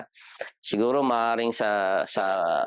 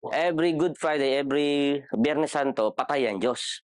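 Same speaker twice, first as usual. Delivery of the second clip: ordinary delivery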